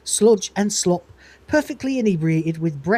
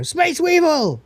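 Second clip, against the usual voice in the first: alarmed cry; 11 semitones higher, 4 dB louder, slower than usual. Retold or imitated. Both spot the Space Weevil.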